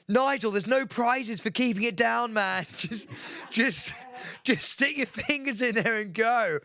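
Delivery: imitating Marvin. Marvin calls to sick Nigel, mock bossy shout. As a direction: impersonate a mate yelling advice. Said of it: "hear Marvin from the corner going".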